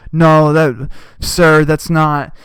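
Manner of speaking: a staffer's stammered objection; loud flustered objection; flustered aide protest. Retold or imitated. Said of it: "And they're like".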